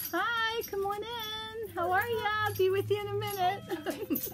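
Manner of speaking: high, sing-song call of welcome; friendly greeting. Real, not acted. Shopkeeper to customer arriving during recording, real friendly greeting. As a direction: call out cheerily to someone coming in.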